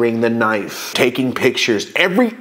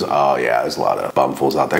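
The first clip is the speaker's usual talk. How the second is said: mock deep cop; 11 semitones lower than usual. Imitating the cop.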